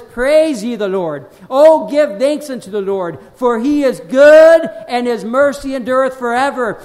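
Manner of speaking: loud, ringing scripture proclamation; preaching. Acted. Preacher proclaims a psalm to the congregation, forceful preaching. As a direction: proclaim the praise, punching the key words hard.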